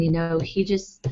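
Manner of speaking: ordinary delivery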